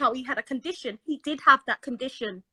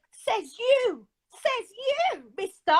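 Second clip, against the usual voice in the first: teasing sass; 6 semitones higher, much slower than usual. Retold or imitated. Teasing reply quoted.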